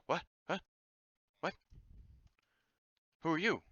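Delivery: high gasping surprise; startled gasp. Acted. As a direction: jump at the sudden stranger.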